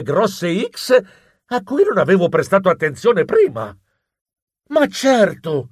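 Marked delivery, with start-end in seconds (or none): 4.7-5.7 slow, drawn-out exclamation of realization; sudden realization, drawn out; exclaiming. A narrator's reading. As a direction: exclaim it, stretching the words.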